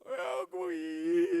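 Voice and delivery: high, slow, crying little voice; tearful child confession. Retold or imitated. Young son confessing to his father, crying. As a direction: let the boy break down as he admits it.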